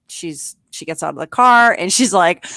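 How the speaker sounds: ordinary delivery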